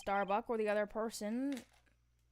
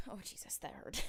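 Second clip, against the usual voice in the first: whispered; 7 dB quieter than usual. Real, not acted. Whispered after being hurt.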